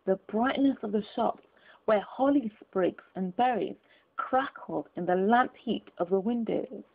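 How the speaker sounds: plain reading voice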